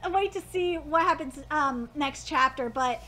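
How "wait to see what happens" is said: ordinary talk, nothing marked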